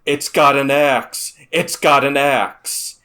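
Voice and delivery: loud, slow, frantic warning shout; panicked yell. Retold or imitated. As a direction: scream the warning over the radio. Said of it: "I just yelled over the radio".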